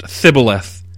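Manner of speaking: "Thiboleth" begun with a TH sound; alternative pronunciation. Shown as a demonstration. Preacher citing what some scholars think, alternative TH pronunciation. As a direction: say "Thiboleth" with th in place of sh. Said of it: "might even be pronounced Thiboleth".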